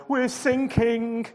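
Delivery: panicked sailor. A narrator's reading. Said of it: "cried a sailor".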